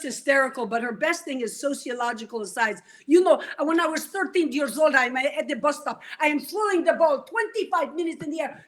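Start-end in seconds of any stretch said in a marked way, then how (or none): none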